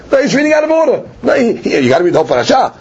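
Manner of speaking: lightly acted, high-pitched protest from the congregation; protesting onlookers. Voicing the people's objection to the reader.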